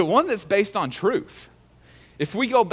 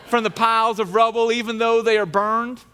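mocking taunt voice; 5 semitones higher, 4 dB louder than usual. Sanballat taunts the builders with a scornful voice. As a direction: ham it up like a heckler. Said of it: "stop the voice".

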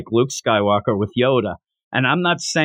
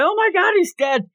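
high-pitched exclamation of horror; hammed-up freak-out over a body; mock panic. Acted horror at finding a body.

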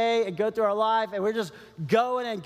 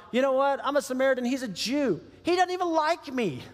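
indignant Samaritan; 7 semitones higher than usual. Pretend. Pastor voicing the Samaritan's excuse, indignant. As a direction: voice the Samaritan's petty objection.

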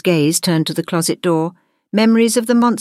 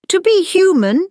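high, thoughtful opening; 4 dB louder than usual. A narrator's reading. Teacher starting a lesson for monsters, thoughtful. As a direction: begin it as a pondered lesson, pitched high. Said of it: "she started thoughtfully".

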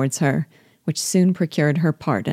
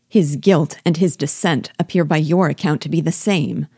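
plain reading voice

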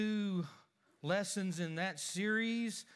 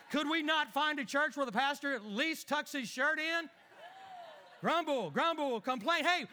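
higher, whiny complaining about the pastor; mocking complaint; 5 semitones higher than usual. Husband gripes to family about the pastor's clothes, raised and whiny. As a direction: gripe sarcastically about church dress. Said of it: "my thespian ability".

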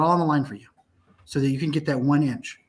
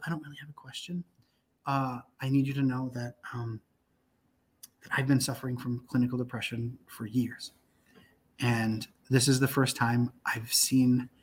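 barely voiced, tender confession; hushed confession; 6 semitones lower, 5 dB quieter than usual. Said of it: "and she goes".

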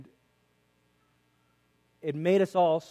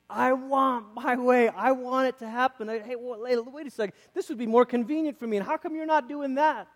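mock entitled whine, pitched up; whiny demand; 8 semitones higher than usual. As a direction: whine like a spoiled child who wants it now. Parody of people wanting their way.

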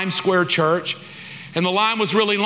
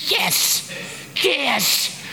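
high breathless yeses; can barely contain the joy; thrilled yes; 8 semitones higher than usual. Retold or imitated. Following the usher to the seats, a thrilled high yes.

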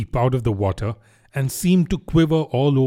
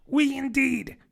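very high, piping mouse voice; affronted little mouse; indignant mouse cry; 12 semitones higher than usual. A narrator's reading. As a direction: squeak out your offence. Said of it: "cried the mouse".

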